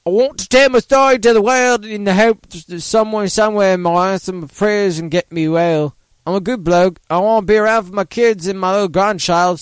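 a radio host reading a quote in a weak mock Australian accent. Host tries an Australian accent on the quote. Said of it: "can I do an Australian accent?".

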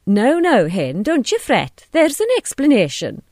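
flustered husband explaining himself; placating husband. A narrator's reading. Reverend Hughes placates his wife.

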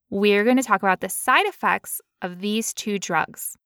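usual speaking voice

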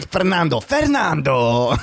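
mock Russian calling out a name; Russian accent. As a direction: laugh through a put-on Russian accent. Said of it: "this is my best Russian accent".

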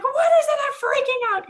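very high, loud retold panic; panicked squeal. Blindfolded client hearing tape, panicked squeal. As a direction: squeal it in mock terror.

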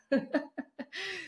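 a light amused chuckle; laugh. Real, not acted. Laughs after Toyota disclaimer.

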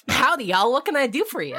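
high, folksy Southern cowboy greeting; cheery Southern merchant welcoming customers; comic Southern twang breaking the suspense; Southern accent. Acted. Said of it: "he always talks in a southern accent".

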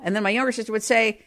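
normal talking voice

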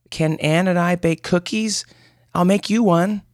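put-on child voice begging to bake; little girl asking. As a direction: ask sweetly like a six-year-old.

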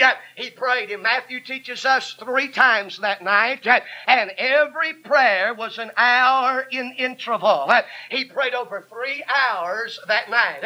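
punchy revival sermon cadence; impassioned country-church preacher delivery; fervent preaching. Real, not acted. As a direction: preach it hard, phrase by phrase. Preaches at full force.

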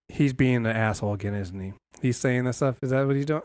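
hushed, low sly voice, barely above a breath; lightly voiced Arthur, hushed and low; quiet mock Arthur.

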